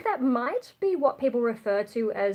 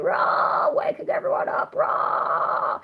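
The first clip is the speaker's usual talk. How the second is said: raspy whispered fry yell; fry scream; 14 semitones higher, 3 dB louder, slower than usual. Shown as a demonstration. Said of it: "the more, like, Fry kind of thing".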